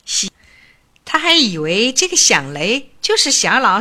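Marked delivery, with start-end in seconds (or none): none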